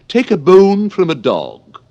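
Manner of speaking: high, loud, slow imperious question; Red Queen. Acted. Red Queen testing Alice with a riddle, high loud and slow. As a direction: pose the riddle grandly and sternly. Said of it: "the Red Queen answered for her".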